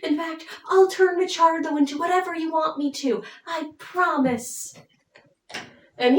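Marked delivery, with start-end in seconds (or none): 0.0-4.7 high, cooing reassurance; comforting. Retold or imitated. Alcina comforts her jealous lover, high cooing reassurance. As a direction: play a sweetly pleading lover, pitched up. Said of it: "she actually tries to comfort him".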